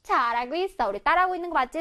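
normal talking voice

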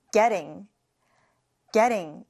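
getting with a flapped t, like "gedding"; soft t in getting. Shown as a demonstration. Teacher models getting for students, flapped t. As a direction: soften the t in getting to a quick tap. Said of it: "T 발음을 부드럽게 해주셔야 합니다".

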